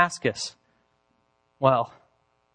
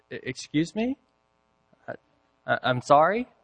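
stammering, puzzled questions with rising endings; politely baffled stammer; taken aback. Retold or imitated. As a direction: stumble in disbelief, voice lifting at the end. Acts out his stunned reply to threat.

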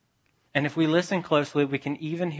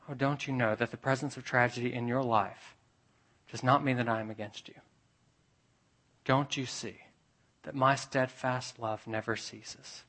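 softer, tender reassurance; God's whisper; 8 dB quieter than usual. Acted. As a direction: hush it like a comforting voice from above. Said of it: "Could that be God's whisper to say".

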